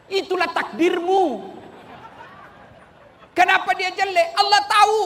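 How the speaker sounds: high, loud shouted sermon; fiery preaching. Acted. Preacher shouting advice to wives and husbands, fiery preaching. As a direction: preach at full force to the crowd. Preaching at full force to crowd.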